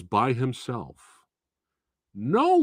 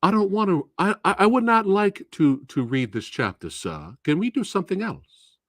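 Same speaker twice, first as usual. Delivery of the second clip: mock-courteous pupil, higher and sweetly insolent; overly polite student; 7 semitones higher than usual. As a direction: play the falsely respectful student with a higher voice. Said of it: "this is how they talk to you".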